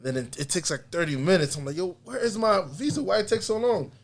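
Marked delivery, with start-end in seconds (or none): 1.8-3.9 high-pitched impatient complaint; retold annoyance. Traveler kept waiting for his visa, mock impatience. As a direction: act out getting mad at the wait. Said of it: "so I'm getting mad".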